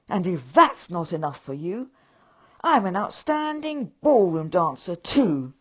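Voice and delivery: puffed-up boasting, outraged; pompous indignation. Acted. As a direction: Lord Grumpkin lecturing the elves loudly on his status. Rat lord indignant speech.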